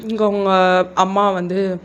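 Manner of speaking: usual speaking voice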